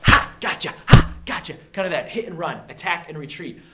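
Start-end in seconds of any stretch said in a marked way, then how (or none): 0.0-1.5 explosive "ha!" bursts, much louder; mock ambush. Teacher demonstrating hit and run fighting, explosive mock ambush. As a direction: act out quick hit-and-run jabs. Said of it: "you know, kinda like".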